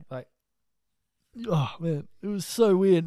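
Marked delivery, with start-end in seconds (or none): 1.5-3.0 exclaiming. Real, not acted.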